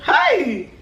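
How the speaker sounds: high, drawn-out "hey" of dismay; storyteller's high yelp of regret; dismayed cry. Retold or imitated. Reacting to her own mistake.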